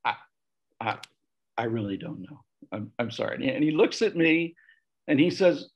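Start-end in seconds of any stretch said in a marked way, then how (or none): 0.0-3.3 soft, low, sheepish admission. Retold or imitated. Failing the priest's question at communion, sheepish. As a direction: falter softly, embarrassed not to know. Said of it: "I looked at him as sheepishly".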